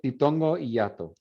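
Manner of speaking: normal talking voice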